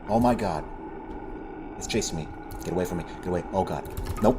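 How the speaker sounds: panicked yelps while being chased; frightened, urgent pleading; scared horror game streamer. Real, not acted.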